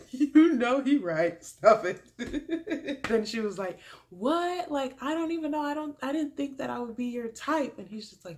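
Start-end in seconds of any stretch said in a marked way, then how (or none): 0.1-3.0 higher, loud drawn-out tease breaking into laughter; teasing squeal. Retold or imitated. Host teasing the woman on screen, laughing squeal. 4.1-7.8 higher-pitched, acted-out surprise; mock surprise. Woman hearing he likes her, imitated surprise.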